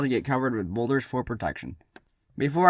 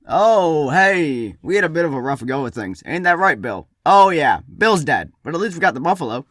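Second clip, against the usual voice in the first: loud, higher comic greeting; upbeat returning hunter; 5 semitones higher, 8 dB louder than usual. Acted. Hunter back with the buffalo after losing Bill, loud and upbeat. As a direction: play the hunter breezing past a mishap.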